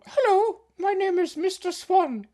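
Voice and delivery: very high, squeaky cartoon swan; high squeaky voice for Mister Swan; silly swan voice. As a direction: play a prim little swan. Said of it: "Swan, Swan".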